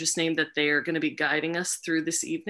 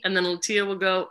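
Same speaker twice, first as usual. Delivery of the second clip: normal talking voice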